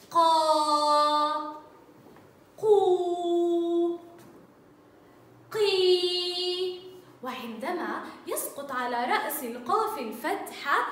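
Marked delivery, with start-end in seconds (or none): 0.1-6.8 قا، قو، قي stretched and loud, each long vowel held; long vowel sounds. Shown as a demonstration. Teacher modelling qaf with long vowels for pupils, loud and drawn out. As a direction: voice each syllable clearly and loudly for the class. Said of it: "عندما تتصل بحرف المد الآ تصبح".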